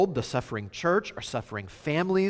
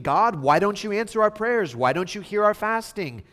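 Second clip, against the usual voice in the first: raised, indignant pleading; higher-pitched mock protest; appalled complaint; 8 semitones higher, 5 dB louder than usual. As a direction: act out people protesting up to God. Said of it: "we're appalled that God is not doing anything".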